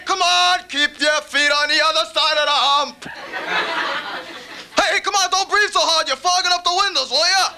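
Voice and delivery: a fussy father griping from the passenger seat; dad nagging his kid at the wheel, high and whiny; impression of dad. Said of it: "my impersonation of my dad in the car".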